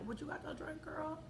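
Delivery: high, very soft voice; coy asking. Retold or imitated. Friend asks the host for a drink, coy and soft. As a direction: ask it small and hushed.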